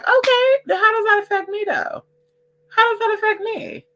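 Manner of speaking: high sing-song so what; mock shrug. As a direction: toss it off in a squeaky mock voice. Mock indifference question.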